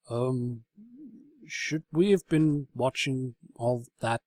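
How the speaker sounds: uneasy slow voice; awkward onlooker. A narrator's reading. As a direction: hesitantly ask the room. Shining Armoire's voice.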